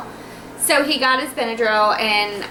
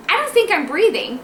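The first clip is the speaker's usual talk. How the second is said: high-pitched mock alarm, retold; waking-up alarm; 7 semitones higher than usual. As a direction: act out waking worried, voice pitched up. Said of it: "I'd wake up and I'd go".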